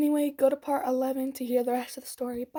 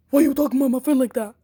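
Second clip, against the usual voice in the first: angry confrontation; 10 dB louder, faster than usual. Retold or imitated. Friend confronts her angrily.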